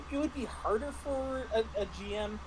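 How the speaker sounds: ordinary talk, nothing marked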